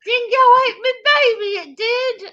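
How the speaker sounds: high mock-tearful Aussie lament; hammed-up fake Australian, British-sounding; bad fake Australian. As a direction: whimper it in an awful Australian accent. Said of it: "It's really bad British".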